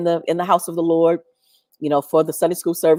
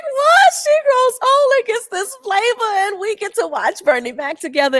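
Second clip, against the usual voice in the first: delighted disbelief. Retold or imitated. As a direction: cry out in thrilled amazement. Retold delighted shock.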